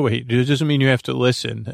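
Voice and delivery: ordinary talk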